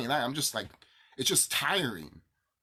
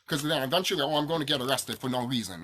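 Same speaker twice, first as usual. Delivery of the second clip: ordinary talk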